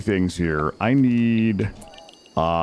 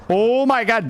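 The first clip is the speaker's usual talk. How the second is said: surprised exclamation; 10 semitones higher than usual. Real, not acted. Sudden game surprise.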